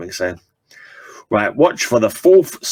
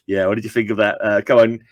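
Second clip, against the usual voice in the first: ordinary delivery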